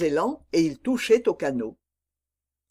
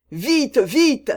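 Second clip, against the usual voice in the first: urgent shout; 8 semitones higher, 6 dB louder than usual. A narrator's reading. Aramis urging Porthos on.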